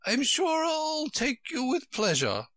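very high-pitched storybook queen; high Queen correcting Alice; fussy Queen. A narrator's reading. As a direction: put on a high, querulous royal voice.

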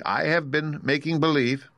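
high-pitched, meek little voice; meek confession. Acted. Little man confessing to his visitors, meekly. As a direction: own up timidly. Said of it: "said the little man meekly".

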